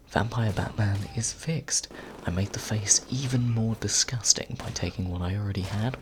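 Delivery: ordinary delivery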